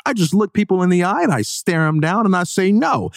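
high, scoffing mock laugh and boast; mocking know-it-all. Mocking smug people who just say no, in a high scoffing voice. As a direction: lampoon the smug people who say it's easy. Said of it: "there are always people that are like".

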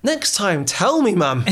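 high-pitched, exasperated telling-off of mum; mock livid. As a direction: play up the outrage at mum. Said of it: "I was livid when I found this out".

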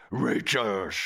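"Reachers" with slushy sh sounds; mock-Dutch lisp on "Reachers". Shown as a demonstration. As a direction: lisp the end of "Reachers" into a drawn sh. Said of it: "if you just add the lisp".

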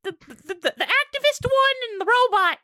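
very high witch yelp; high hag hey; Hagatha voice. Acted. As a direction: call out like a squeaky hag. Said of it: "Hagatha's voice going".

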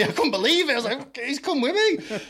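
high, laughing amazement; laughing disbelief. Real, not acted. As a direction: laugh in delighted surprise. Amazed the kid came along.

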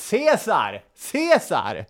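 high call without real volume; half-voiced crowd cry; mock heckling shout. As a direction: imitate a heckler calling out an accusation. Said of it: "någon börjar ropa".

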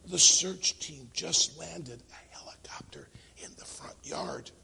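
put-on hushed little boy on the phone; whisper. Child reporting to the boss, whispering. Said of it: "again whispering, the child answered back".

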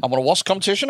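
mock it back at him; mocking imitation. Friend mocks the opening line.

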